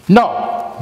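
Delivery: high, loud "No." barked out; exclamatory no. Shown as a demonstration. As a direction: exclaim "No." sharply. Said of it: "very exclamatory".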